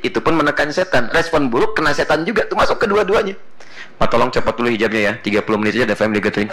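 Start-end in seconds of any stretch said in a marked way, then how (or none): 4.0-6.5 rushed quote. Retold or imitated. Quoting someone's excuse.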